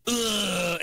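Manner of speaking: hammed-up throaty "ugh" before the line; imitated throat grunt. Imitating Will Sasso warming up his accent, throaty grunt. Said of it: "So Will Sasso would go".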